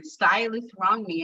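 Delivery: ordinary delivery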